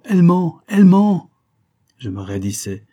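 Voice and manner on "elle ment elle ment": high, loud repeated accusation; desperate shouted protest; accused man's cry. A narrator's reading. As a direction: shout it out, harder the second time. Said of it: "répétant".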